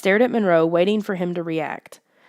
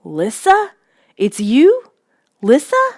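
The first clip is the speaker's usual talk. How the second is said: rising, louder, choked disbelief; 6 semitones higher, 7 dB louder, slower than usual. A narrator's reading. Narrator stunned to see Lissa, choked and rising. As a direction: force the name out in shock. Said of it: "I choked out".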